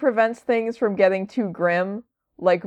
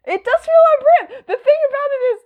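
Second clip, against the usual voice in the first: laughing; 4 dB louder, faster than usual. Real, not acted. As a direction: talk through high-pitched laughter. Cracking up at co-host's remark.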